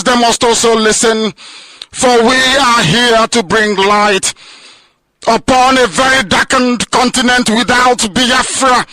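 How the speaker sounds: high, loud fiery sermon cadence; preaching at full force. Acted. Leader rallying listeners on radio, preaching at full force. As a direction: thunder it out like a pulpit preacher.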